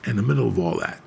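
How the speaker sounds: ordinary talk, nothing marked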